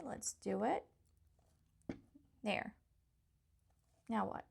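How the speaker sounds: usual speaking voice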